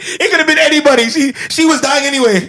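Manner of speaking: high-pitched laughing through the words; cracking up while talking. Real, not acted. Friend laughing about the killing scene, high and giggly.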